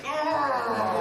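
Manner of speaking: loud, high exasperated yell; frustrated scream. Retold or imitated. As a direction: let it all burst out at the husband. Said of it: "And you're like".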